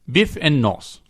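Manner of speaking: lightly put-on English-sounding greeting; a hint of an English accent; Fritas impression. Co-host imitating the letter writer, faintly English accent. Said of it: "Now do your impression".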